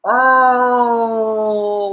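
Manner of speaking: long, loud, sustained "oh"; drawn-out cry of dismay; despairing groan. Real, not acted. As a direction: groan it out at length. Despairing at the slap.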